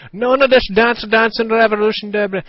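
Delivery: high, loud parody of a mother who won't listen; mocking mom. The mother dismissing his video game explanation, mocked high and loud. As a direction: brush him off in a shrill mother voice. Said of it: "She's like".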